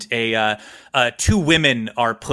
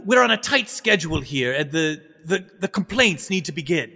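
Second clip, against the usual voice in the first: put-on higher-pitched, halting old courtier; old adviser, pleading; 7 semitones higher than usual. Old man approaches his lord, pleading nervously. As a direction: beg his lordship with fussy deference. Whisperio appeases the emperor.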